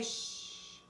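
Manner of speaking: unvoiced shh, very soft; soft silent-E shush. Shown as a demonstration. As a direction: hush like a librarian. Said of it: "and I'm gonna say".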